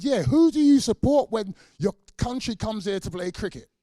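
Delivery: loud, high, jeering question; mock official challenge. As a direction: bark it out like a smug official. Officials pose the cricket test.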